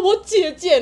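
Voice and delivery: high, loud half-laughing choked plea. Retold or imitated. Telling the hotline her sister, mock choked sobbing. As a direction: retell the sob through laughter. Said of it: "我就很哽咽地说".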